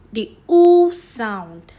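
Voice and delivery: oo held long and loud, slowly; teacher stretches the long oo in oo sound. Shown as a demonstration. Teacher shows pupils the long oo, slow and loud. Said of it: "This is the long oo sound".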